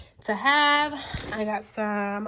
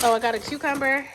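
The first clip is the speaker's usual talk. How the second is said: ordinary talk, nothing marked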